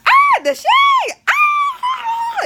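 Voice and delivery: very high, loud, drawn-out scream; over-the-top high squeal; scandalized shriek. Retold or imitated. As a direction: shriek it slowly in mock shock. Reenacting shock at the shade.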